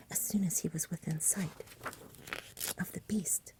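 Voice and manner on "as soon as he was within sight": ordinary delivery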